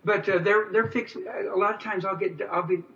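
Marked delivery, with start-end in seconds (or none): none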